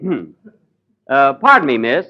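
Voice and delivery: ordinary delivery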